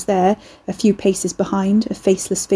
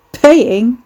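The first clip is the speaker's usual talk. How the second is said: loud, higher incredulous question; raised, mocking echo; scornful snort; 4 semitones higher, 7 dB louder than usual. A narrator's reading. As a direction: throw the word back with a scoff. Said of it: "Ricky snorted".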